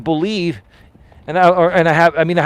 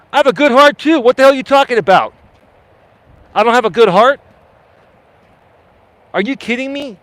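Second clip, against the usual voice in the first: higher and louder, offended; indignant outburst; 6 semitones higher, 4 dB louder than usual. Real, not acted. Real offence at a viewer's remark about his heart, indignant outburst. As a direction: fire back at the chat, genuinely stung.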